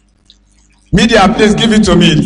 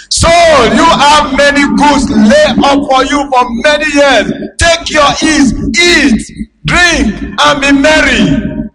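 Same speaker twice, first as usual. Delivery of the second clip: rich man gloating; 7 semitones higher than usual. Acted. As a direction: belt out the rich man's boast. Preacher voices rich man to soul.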